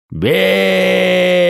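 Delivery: loud, high, long-held war cry; thundering shout. A narrator's reading. Droy orders the archers to strike, thundering drawn-out shout. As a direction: bellow the order, holding the vowel long. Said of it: "загремел окрик Дроя".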